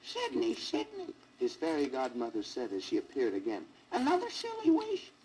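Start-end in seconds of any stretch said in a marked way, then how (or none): 0.0-1.1 put-on high squeaky old fairy voice. A narrator's reading. Fairy godmother squeaking down at the sad elephant. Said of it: "squeakily said". 3.9-5.1 put-on high squeaky old fairy voice. A narrator's reading. Fairy godmother squeaking down at the sad elephant. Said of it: "squeakily said".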